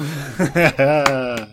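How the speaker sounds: loud, high tipsy laugh after the song; loud high drunken chuckle. Acted. Drunk man after singing.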